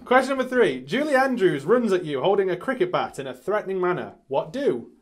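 loud shouted question; quiz show host. Acted. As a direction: announce it at full volume. Plays rapid-fire quiz host.

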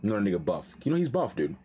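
ordinary talk

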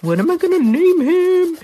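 high, rising worried repeat; high mock-worried voice; panicked wondering. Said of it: "I was like".

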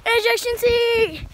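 high shouted call-out; excited call-out. Real, not acted. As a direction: yell the move name as you bounce. Calling out trampoline move.

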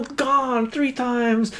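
fast, high auctioneer call. Acted. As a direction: rattle it off like an auctioneer.

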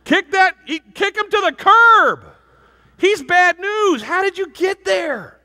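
high, loud orders barked out slowly; emphatic shouting. Retold or imitated. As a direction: scold her like a furious big brother. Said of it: "And I'm like".

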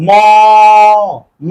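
ম said slowly on its own to expose the অ; isolated syllable. Shown as a demonstration. Teacher sounding out ম for students. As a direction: sound out ম with its inherent অ. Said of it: "ম এর মধ্যে দেখেন একটা অ আছে না".

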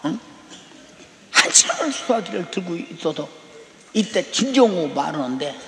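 ordinary talk

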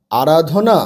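আরাধনা joined up as one word; quick reading. Shown as a demonstration. Teacher showing pupils the whole word, read quickly. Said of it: "তাড়াতাড়ি পড়লে".

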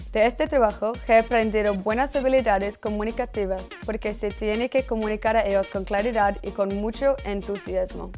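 ordinary talk

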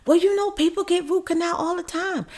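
high, offhand mock dentist brush-off; mock dismissive dentist.

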